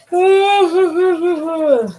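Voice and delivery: high, loud burst of real laughter. Real, not acted. Streamer breaks off her story, bursting into laughter. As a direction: laugh breaks out before the sorry. Sudden burst mid-sentence, then sorry.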